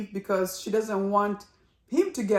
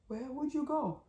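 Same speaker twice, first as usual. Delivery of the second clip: higher, much softer plaintive echo; imitating him, softer; 7 semitones higher, 8 dB quieter than usual. Husband repeats the question to his wife, high and hushed. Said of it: "that part when he said".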